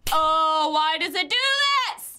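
panicked shrieks while being chased; panicking gamer. Real, not acted. Chased by Baldi in game.

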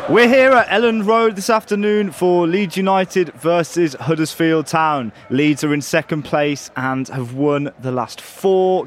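mock match commentator; football commentary. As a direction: call it like TV commentary.

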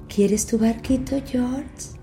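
high, slow coaxing clown; giggly sinister sing-song. Acted. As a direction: tempt him with false sweetness. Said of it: "Soy Pennywise, el payaso".

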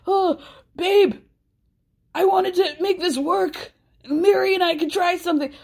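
hammed-up overwrought plea; mock screaming. Host mimicking Cody pleading with Sukanya, mock screaming. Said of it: "So as he's screaming".

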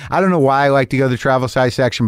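ordinary delivery

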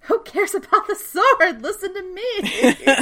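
high, loud demand for attention, drawn out at the end; mock shouting. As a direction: exclaim it high and stretch the last words. Acted quote shouted at the opening.

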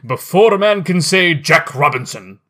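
put-on loud, hearty jolly old boss; jovial fat voice. A narrator's reading. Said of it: "cried old Fezziwig".

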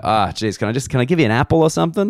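imitating a flustered attendant, rising high and quick; mock helpless apology, climbing in pitch; apologetic staff. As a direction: rush the offer out apologetically. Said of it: "the Qantas people apologize to me".

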